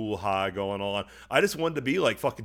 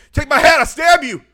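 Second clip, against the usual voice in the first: very high, very loud crazed threat; hammed-up furious scream; screaming stabber; 16 dB louder than usual. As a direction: shriek it like an enraged maniac. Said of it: "I think he said".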